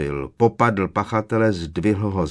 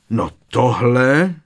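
slow, louder voice of an affronted professor; indignant; 4 semitones higher, 6 dB louder, slower than usual. A narrator's reading. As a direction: draw it out, scandalised and raised. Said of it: "ozval se pohoršeně profesor Tofty". (pohoršeně, protahovaně)

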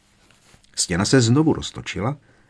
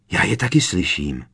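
usual speaking voice

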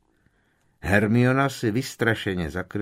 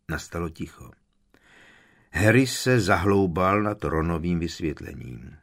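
ordinary delivery

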